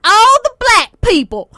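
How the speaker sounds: loud, high, drawn-out mock country accent; imitated country accent. Principal calling out who is leaving graduation, loud imitated country accent. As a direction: call it out slowly in an exaggerated country accent. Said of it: "that's exactly how she said it".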